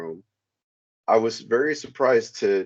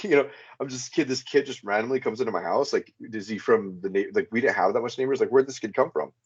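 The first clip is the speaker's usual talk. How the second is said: usual speaking voice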